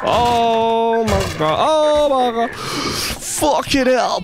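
high, drawn-out groaning shout; exasperated shouting. Real, not acted. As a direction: wail it out in disbelief. Frustrated at missed achievements.

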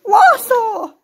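high, loud burst of joy; sudden gleeful yell; excited shout. Acted. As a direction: shout it out with delight. Excited shout of the drink's name.

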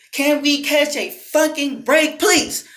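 slow, drawn-out exasperated begging; exasperated plea. Real, not acted. Fed-up creator pleading for people to catch a break, exasperated.

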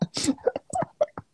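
a silly stoned squeal of a laugh; high giggle. Real, not acted. Giggling about feeling high.

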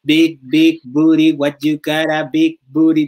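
loud, slow mock English chant; imitating English singer. Imitating a singer for fans, loud and slow. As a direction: belt it out like a cheesy pop hook. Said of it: "yo la imitaba".

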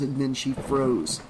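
ordinary delivery, nothing marked

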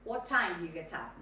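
low "get up", with no singsong lift at the end; falling intonation. Shown as a demonstration. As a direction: stress "time" and let "get up" sink. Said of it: "No cantadito para arriba".

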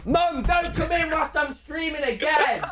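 high, drawn-out yell; yelling at mum. Real, not acted. Streamer telling mum to stay out, high drawn-out shout. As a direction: shout it slowly and high to another room.

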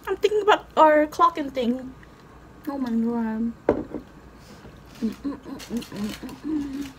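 speaking through a mouthful; talking while chewing. Real, not acted. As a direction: mouth full of bread. Seen 0: Bites just before; pursed full mouth, bitten sandwich in hand throughout.

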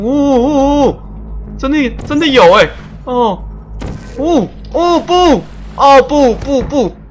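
1 alarmed oh no. Real, not acted. Player sinking in game.

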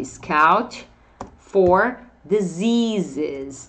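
slow model phrase. Shown as a demonstration. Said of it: "Scout fala desse jeitinho aqui".

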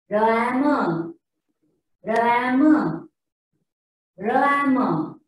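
Rama blended as "ruh-a-muh"; misread with wrong sounds. Shown as a demonstration. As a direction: stretch Rama out of separate letter sounds, ending in "uh". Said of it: "read these words using that sound".

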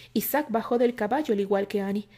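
plain reading voice

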